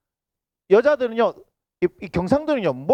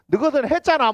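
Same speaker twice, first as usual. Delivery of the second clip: mock accusation; 6 semitones higher than usual. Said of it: "뭐 이렇게 나오면".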